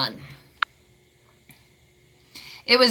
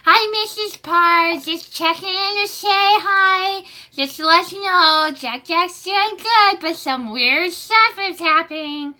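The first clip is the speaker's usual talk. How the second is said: put-on high, slow, sing-song babysitter voice; sweet babysitter; 9 semitones higher, 4 dB louder than usual. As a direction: chirp it like a sugary babysitter on the phone. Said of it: "That was a babysitter".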